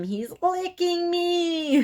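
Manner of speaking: high, slow shriek with a strange, different voice; retold scream. Toffler screaming in the night, high slow shriek. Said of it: "Toffler scream".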